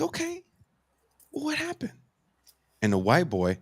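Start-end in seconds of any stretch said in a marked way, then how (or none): 0.0-1.9 high, soft, careful voice; gentle question. Retold or imitated. Bandleader coaxes the calmed girl for her story, soft high question. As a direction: ask delicately, pitched up and quiet.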